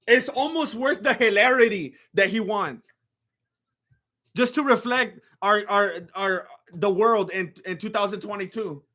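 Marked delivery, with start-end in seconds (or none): none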